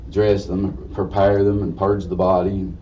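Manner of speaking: usual speaking voice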